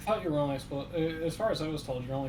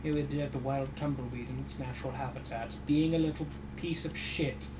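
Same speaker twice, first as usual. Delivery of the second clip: nature documentary. Pretend. Continues tumbleweed documentary joke.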